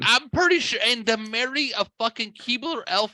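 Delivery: high-pitched ranting about a buddy; incredulous rant. Retold or imitated. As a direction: rail on in exaggerated disbelief. Retold mock rant resumed.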